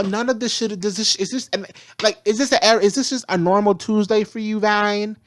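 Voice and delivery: normal talking voice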